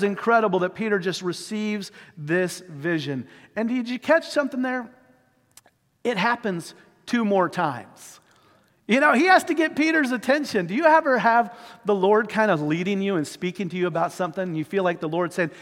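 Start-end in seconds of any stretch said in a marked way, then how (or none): none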